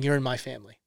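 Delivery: softly voiced Jesus welcoming the woman; tender Jesus. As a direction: say it gently, like welcoming her home. Voicing Jesus to the outcast.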